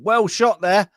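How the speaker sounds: high, loud cheer of praise; mock encouragement. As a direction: call out hearty praise to the troops. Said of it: "also encouraging them".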